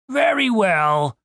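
drawn-out reluctant soldier; Yellowteeth voice. Acted.

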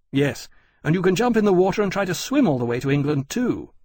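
exasperated sarcasm; sarcastic retort. A narrator's reading. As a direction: ridicule the plan with mock agreement. Riley mocks Granby's plan.

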